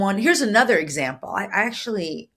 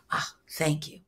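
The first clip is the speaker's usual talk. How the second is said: soft heartfelt "Ah, thank you"; deep grateful thanks; 3 semitones lower, 7 dB quieter than usual. Shown as a demonstration. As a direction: let the thanks sink in deep. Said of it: "there's more rootedness".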